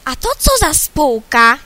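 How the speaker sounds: loud indignant cry; cuckoo yelling. Acted. Cuckoo yelling at the rooster, loud and indignant. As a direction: shout it out like an offended cuckoo. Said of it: "wrzaśnie".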